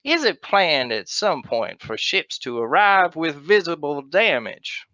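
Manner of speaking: put-on slow, drawn-out Texan accent; theatrical Texan accent, stretched-out words. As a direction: read it out in a put-on Texan drawl. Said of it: "Read it in the Texan voice".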